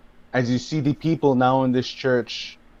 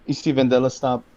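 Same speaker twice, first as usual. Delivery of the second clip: normal talking voice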